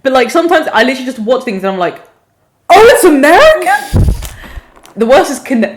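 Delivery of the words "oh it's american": high-pitched mock astonishment; surprised realisation. Viewer suddenly realising the show is American, surprised. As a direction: rise sharply into a startled question.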